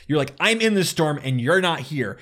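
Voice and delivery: retold yelling. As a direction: replay a friendly shouting match. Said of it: "we were, like, yelling at each other".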